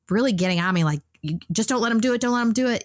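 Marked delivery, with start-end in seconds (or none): 1.2-2.8 higher, urgent insistence; frantic nurses. Retold or imitated. Nurses pressing her: higher, urgent mock insistence. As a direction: mimic the nurses' anxious warning. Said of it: "they kept getting really frantic".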